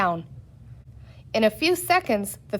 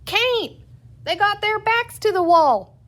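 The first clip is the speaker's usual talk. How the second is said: high, loud yell back; calling back; 11 semitones higher, 4 dB louder than usual. A narrator's reading. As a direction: call it back across the mob. Crowd member calls back.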